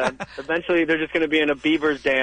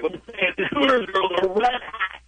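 radio host hype; 5 semitones higher than usual. Acted. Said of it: "radio shows tell you how hot".

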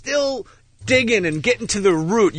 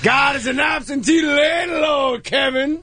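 higher, slow Pacino shout; Al Pacino yelling; 8 semitones higher, slower than usual. Retold or imitated. Quoting the Devil movie to Kevin, slow Pacino shout. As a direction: belt it like a Devil's Advocate sermon. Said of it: "I start talking like this".

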